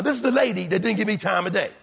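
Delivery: ordinary talk, nothing marked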